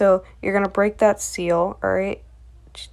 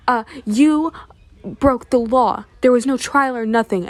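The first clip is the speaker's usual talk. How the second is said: indignant protest; 4 semitones higher, 5 dB louder than usual. Retold or imitated. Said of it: "Julia is like".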